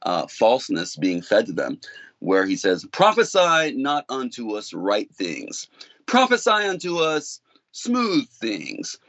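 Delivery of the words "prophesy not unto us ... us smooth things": slow, rising mock of the people; drawn-out taunting quote; a hint of God mocking Israel. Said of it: "God is mocking".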